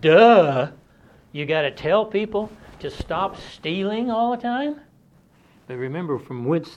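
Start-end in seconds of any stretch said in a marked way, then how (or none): 0.0-4.8 incredulous mock reaction. Church-raised people reacting to being told not to steal, incredulous. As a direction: scoff at the obvious, pitch climbing into the question. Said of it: "you might think".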